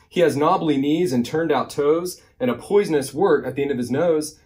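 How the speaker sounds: lively, singsong picture-book reading; animated storytime narration; reading to children. A narrator's reading. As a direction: read the rhyme with big bouncy emphasis. Reads a rhyming picture book.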